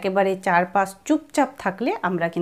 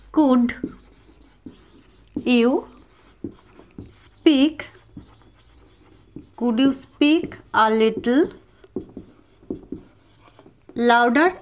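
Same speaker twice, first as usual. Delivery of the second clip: very slow, long pauses between words; word by word drill; 2 semitones higher, much slower than usual. Shown as a demonstration. Teacher drilling a sentence word by word, slow. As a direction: break it into single words for repetition. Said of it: "এইভাবে বলতে পারি".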